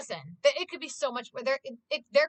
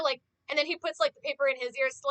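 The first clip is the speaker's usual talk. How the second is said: ordinary delivery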